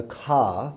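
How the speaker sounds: car said as کھا, R dropped; British pronunciation. Shown as a demonstration. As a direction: say کھا with puffed K and silent R. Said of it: "جیسے اگر British کہہ دیتا ہے". (برطانوی انداز میں "کھا"، ر کے بغیر)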